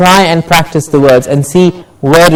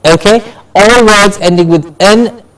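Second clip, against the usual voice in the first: usual speaking voice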